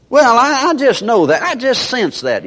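high, hesitant mock whine; high whiny doubter. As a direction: squeak it out like a scared believer. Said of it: "there's people that say".

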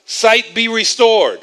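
loud, slowing, pompous healing decree; grand proclamation. Retold or imitated. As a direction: boom it out like a showy miracle worker. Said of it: "He didn't say".

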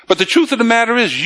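ordinary talk

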